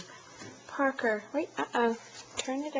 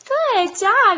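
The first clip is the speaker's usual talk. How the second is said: high, loud cheering praise; excited praise; 12 semitones higher, 10 dB louder than usual. Real, not acted. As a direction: cheer him on, big and bright. Praising toddler, two takes.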